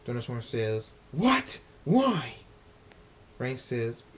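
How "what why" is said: loud, high burst on each word; sharp, raised outburst; outraged demand. A narrator's reading. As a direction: bark out shocked questions. Thunderstorm enraged, storm roars.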